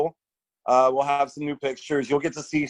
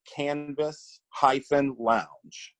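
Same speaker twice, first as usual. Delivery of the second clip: slow and clear; 4 dB quieter, much slower than usual. Real, not acted. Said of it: "spelling out handle slowly".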